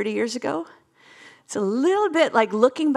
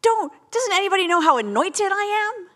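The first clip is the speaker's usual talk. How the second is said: indignant complaint; 8 semitones higher than usual. Retold or imitated. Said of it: "I'm like".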